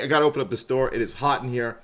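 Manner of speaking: normal talking voice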